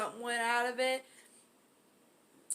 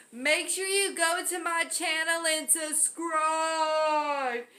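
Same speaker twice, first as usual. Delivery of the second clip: hyped YouTube intro; 6 semitones higher, slower than usual. Acted. Channel intro greeting.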